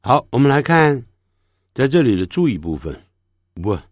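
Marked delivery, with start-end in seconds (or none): none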